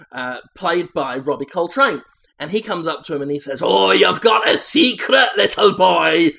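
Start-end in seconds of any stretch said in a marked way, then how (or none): 3.6-6.3 booming, rough giant of a man, louder; big man voice. Acted. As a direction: loom over the boy and boom it. Hagrid-like giant addresses boy.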